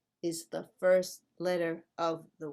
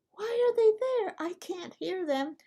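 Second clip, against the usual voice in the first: high-pitched mock complaint; puzzled learner; 11 semitones higher than usual. Teacher voices a learner facing silent letters, puzzled learner. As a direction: put on a high, exasperated student voice.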